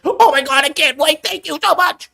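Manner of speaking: high, gushing mock gratitude; gushing influencer impression. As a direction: fawn over the publisher in a squeaky mock voice. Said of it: "We'll play our game since you enjoy it so much".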